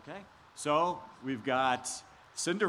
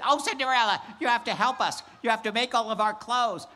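shrill catty stepsister; 7 semitones higher, 4 dB louder than usual. Acted. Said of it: "two mean, ugly stepsisters said".

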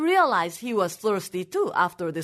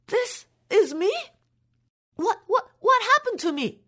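shocked disbelief; 9 semitones higher than usual. Acted. Old man seeing his young face, stunned gasp and stammer. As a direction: gasp then higher stunned questions.

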